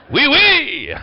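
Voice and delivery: laughing mock French oui; playful hint of a French accent. Pastor joking to the congregation in mock French. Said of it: "I'm getting real French right now".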